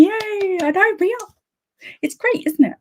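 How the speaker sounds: cheering with the camp leader in chat; cheering yay. Real, not acted. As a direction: squeal it with delight.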